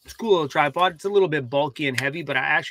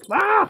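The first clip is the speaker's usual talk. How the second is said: startled yelp; 4 dB louder than usual. Real, not acted. Dog lunges.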